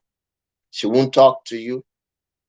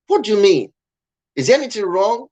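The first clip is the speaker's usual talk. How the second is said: higher-pitched puzzled questioning; retold puzzlement; 8 semitones higher, faster than usual. Husband asks upset wife what is wrong, puzzled retelling. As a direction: act out a baffled husband, pitch raised.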